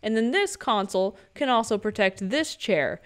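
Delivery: ordinary delivery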